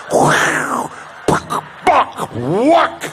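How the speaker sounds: loud whooshing gulp; toilet suction noise. Retold or imitated. Comic mimicking the ship toilet sucking down, loud suction noise.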